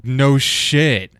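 loud, drawn-out, emphatic retort; scornful outburst. Real, not acted. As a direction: throw it loudly at the critics, stretching both words. Scornful reply to critics.

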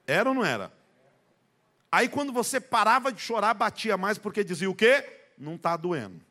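loud, high, rising preacher's cry; building preaching. Real, not acted. Preacher stirs the church over childhood beatings, loud high cry. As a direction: work the crowd up about the beatings.

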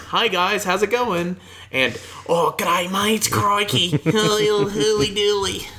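high-pitched hammed-up Australian caricature; mock Australian accent. Co-host imitating how Frank sounds on the podcast, mock Australian accent. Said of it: "which is this accent".

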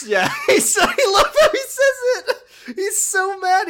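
high squeal of laughter; laughing hard. Real, not acted. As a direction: laugh while talking. Laughing at Dojima's yell.

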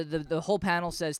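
normal talking voice

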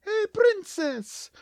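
high, slow cartoon Mario voice. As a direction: greet the princess as Mario. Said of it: "Mario saying".

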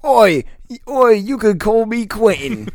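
loud, high, slow mock Cockney; bad British accent. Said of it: "what his British accent would sound like".

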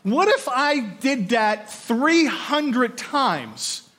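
slow emphasis. Acted. Building the prophecy argument.

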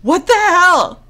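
slow, high, loud disbelief; scolding yourself. Retold or imitated. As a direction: drag out the outrage. Ranting at character.

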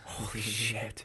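soft, stunned reaction; hushed disbelief. Real, not acted. Cohost hears about two hours on hold, hushed disbelief. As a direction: let it out softly, aghast.

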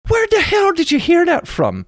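hammed-up disbelief; mock outrage. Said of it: "we're like".

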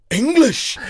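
high, loud Scottish exclamation; explosive mock-disgust in a Scottish accent; disgusted Scotsman. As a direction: spit it out like an appalled Scot. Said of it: "the look on his face of disgust".

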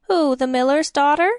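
put-on a nosy old cleaning woman, perking up; chatty grumbling charwoman. Charwoman answers the priest.

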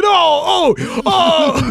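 high, loud mock "Oh, oh"; hammed-up exclamation. Host imitates the suited hologram's cheesy cry. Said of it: "a double-breasted suit saying".

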